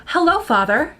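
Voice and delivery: lightly stiff, overly proper hello; prim and proper. Acted. A grown child greeting dad, mock prim and proper. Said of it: "too prim and proper".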